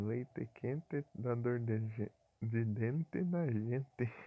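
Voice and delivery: put-on slow, drawn-out southern Paraná accent with a clear final -e; mock Curitiba accent, every word stretched. Streamer mocking the accent from Curitiba, slow and drawn out. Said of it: "tem sotaque que fala assim".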